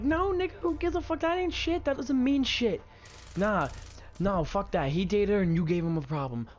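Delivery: heated, high-pitched mock screaming; retold angry yelling. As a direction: shoot him down, voice shooting up. Retelling his screamed argument.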